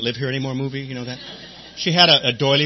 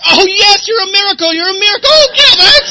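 squealing, much higher and louder, in mock rapture; overjoyed; 13 dB louder than usual. Sponsor gushing with joy at Gilbert, hammed-up squeal. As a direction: gush like he just saw a miracle. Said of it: "he was like".

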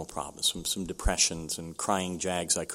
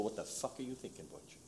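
hushed self-rebuke; 2 semitones higher, 18 dB quieter than usual. Retold or imitated. Scolds himself, remembering suicidal thought.

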